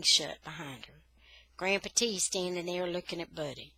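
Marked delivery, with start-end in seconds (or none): none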